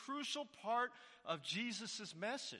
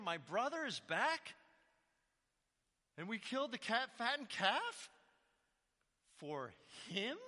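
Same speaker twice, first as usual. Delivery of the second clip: incredulous brother, pitch up and rising at the end; mock outrage at the fattened calf; shocked; 5 semitones higher, 4 dB louder than usual. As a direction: play the older brother, stunned and disbelieving. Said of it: "What a shock to the system".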